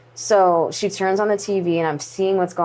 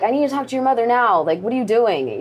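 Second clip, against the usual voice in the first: raised-pitch, mildly scolding dad; quick, mock-cross parental telling-off; mad dad, retold; 6 semitones higher than usual. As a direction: imitate an angry dad's demand, lightly. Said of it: "he just got so mad".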